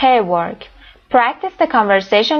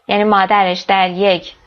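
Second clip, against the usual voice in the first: usual speaking voice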